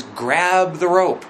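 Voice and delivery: slow, drawn-out call of Grab the rope; a gentle guiding voice, slowed right down. Acted. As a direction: call it out slowly like a rescuer. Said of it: "The voice that says".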